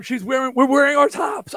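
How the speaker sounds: high, loud, thrilled outburst; a shout of pure delight at the matching outfits; excited shout. Retold or imitated. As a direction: blurt it out, beside yourself with glee. Excitedly pointing at matching tops.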